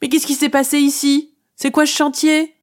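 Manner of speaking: high, startled cry of alarm; exclaiming. Acted. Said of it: "Elle s'écrie".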